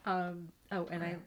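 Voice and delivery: ordinary talk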